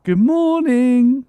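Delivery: high, slow, loud sing-song greeting; cheery high morning call to the dog; sing-song good morning. Shown as a demonstration.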